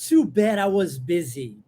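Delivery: Too bad I was busy said slowly and clearly; slow, clear English demo. Shown as a demonstration. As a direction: read the English line slowly for learners. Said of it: "pronúncia de too".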